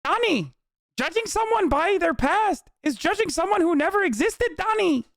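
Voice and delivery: very high, sing-song sage voice; Lang Buddha voice. Pretend. Lang Buddha lecturing Donnie, very high sage voice. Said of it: "what he used to say to Donnie".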